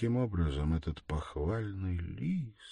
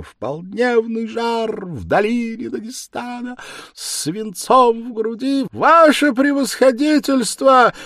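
terrible rasping cry; 15 dB louder than usual. Acted. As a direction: sing it out choking on each word. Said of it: "страшным, хриплым, надрывающимся голосом".

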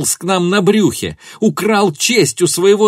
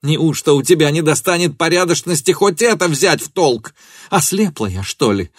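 high, raised, impassioned accusation; furious tirade; 7 semitones higher, 4 dB louder than usual. A narrator's reading. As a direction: pour out hatred and hurt at him. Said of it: "закричала она".

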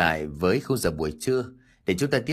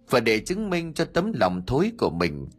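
ordinary delivery, nothing marked

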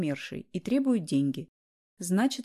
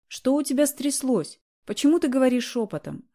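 shouting into the phone; 5 semitones higher, 4 dB louder than usual. A narrator's reading. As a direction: yell it down the phone line. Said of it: "закричала я в трубку".

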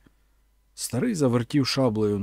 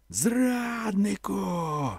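crowd shout; 8 semitones higher, 4 dB quieter, much slower than usual. A narrator's reading. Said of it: "Цей дружний крик".

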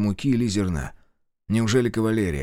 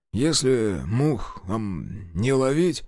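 slow drawl; much slower than usual. Acted. Guard drawls lazily.

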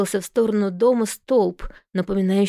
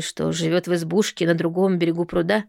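usual speaking voice

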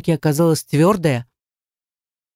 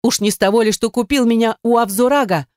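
higher-pitched indignant challenge; flaring up; 6 semitones higher than usual. A narrator's reading. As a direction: throw the question back sharply. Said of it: "взвилась она". (возмущённо, на повышенных тонах)

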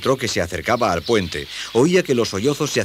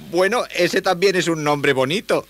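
put-on high boyish voice; high-pitched, well-meaning youngster; polite boy; 10 semitones higher, 4 dB louder than usual. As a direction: offer kind words in a high young voice. Boy consoles the weeping creature.

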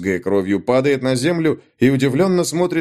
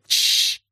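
sharp shushing hiss. Acted. Soldier hushes the old man.

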